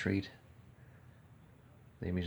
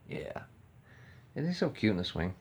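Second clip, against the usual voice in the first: ordinary talk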